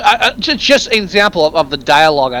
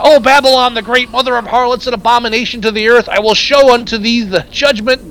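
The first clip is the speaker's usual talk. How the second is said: raised, higher preacher voice; street preacher; 7 semitones higher than usual. Retold or imitated. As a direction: proclaim it like a crazy street preacher. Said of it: "crazy street preacher".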